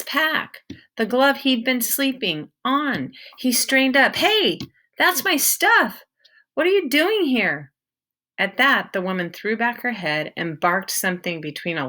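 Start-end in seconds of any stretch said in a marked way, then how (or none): 4.2-6.0 put-on young boy, startled and indignant; a boy protesting, a little slow. 6.5-7.7 put-on young boy, indignant question; a startled boy demanding answers. Peter questions the woman.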